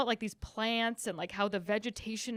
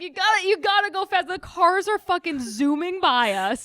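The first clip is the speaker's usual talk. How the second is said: higher, louder urgent mock yell; impatient yell; 7 semitones higher, 7 dB louder than usual. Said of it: "he's like".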